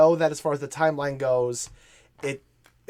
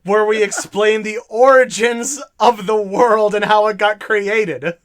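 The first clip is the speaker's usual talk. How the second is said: loud, slow, raised-pitch disbelief; incredulous outburst; 5 semitones higher, 7 dB louder, slower than usual. Real, not acted. Interrupting the lore lesson over a Genesis chapter, incredulous. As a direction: stretch it out in amazed disbelief. Incredulous at game's creation myth.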